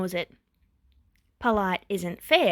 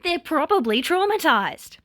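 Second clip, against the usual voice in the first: higher, louder angry snarl; louder snarling retort; 8 semitones higher, 5 dB louder than usual. A narrator's reading. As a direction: snap it back in anger. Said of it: "Fuyumi snarled at him then".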